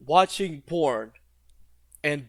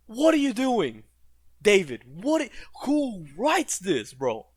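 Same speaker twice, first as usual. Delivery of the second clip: exasperated; 4 semitones higher than usual. Real, not acted. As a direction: throw your hands up at the writing. Exasperated at the show.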